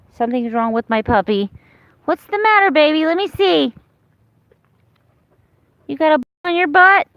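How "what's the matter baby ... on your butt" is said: high, sweet pet voice, much higher. Real, not acted. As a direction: coo at the puppy in a high voice. Talking to her puppy.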